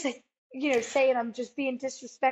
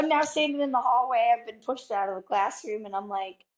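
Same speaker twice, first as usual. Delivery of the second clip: ordinary talk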